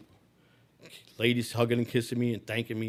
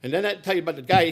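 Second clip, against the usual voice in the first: ordinary talk, nothing marked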